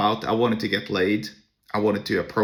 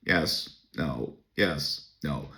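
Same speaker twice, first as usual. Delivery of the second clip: low, soft, bored one-word answers; curt answers to guys he doesn't want to sit with, bored; 7 semitones lower, 8 dB quieter, much slower than usual. Retold or imitated. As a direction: drop every word like you can't wait to leave. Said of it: "very boring tone".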